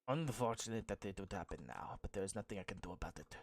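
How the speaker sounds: hushed regretful whisper; soft and quick, almost to himself. Acted. NPC speaks low.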